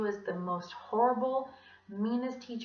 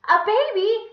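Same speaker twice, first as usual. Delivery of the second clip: high, loud thrilled question; excited; 13 semitones higher, 13 dB louder, slower than usual. Acted. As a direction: squeal it in delight. Kids excited.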